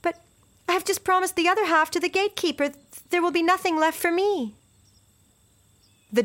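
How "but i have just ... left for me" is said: put-on high, fast pleading girl; a frightened girl protesting; upset little girl. Girl's desperate protest.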